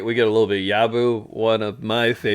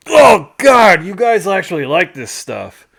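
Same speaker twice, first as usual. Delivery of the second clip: loud, higher disgusted "oh God"; disgusted exclamation; 7 semitones higher, 8 dB louder than usual. Retold or imitated. Dad tasting miso soup for the first time, disgusted. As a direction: recoil from the taste out loud. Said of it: "And he's like".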